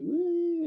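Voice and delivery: high, loud, mock-ecstatic woo; drugged-up whoop. Mimicking someone totally extreme on drugs, loud high whoop. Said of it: "like you're on drugs".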